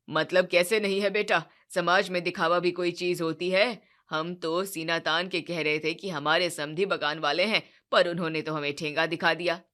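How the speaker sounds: resentful about lost pride; aggrieved father. Acted. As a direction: Sharma ji arguing with his son. Sharma ji on social show.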